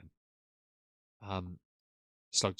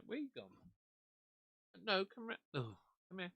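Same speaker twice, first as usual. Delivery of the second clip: pet voice; 9 semitones higher, 13 dB quieter than usual. Real, not acted. Calling the dog over.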